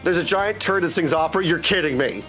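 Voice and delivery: ordinary talk, nothing marked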